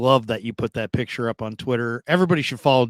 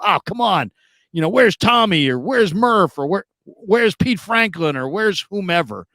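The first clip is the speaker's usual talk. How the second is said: higher-pitched protesting listener; mock complaint; 6 semitones higher than usual. Imagined listeners dispute the list, mock complaint. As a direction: object in a raised, whiny-fan pitch.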